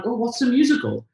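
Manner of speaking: put-on high, curious little-boy voice; child's wonder. Boy asking his mum in the car, high and curious. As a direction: ask it like a wide-eyed six-year-old. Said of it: "I was like".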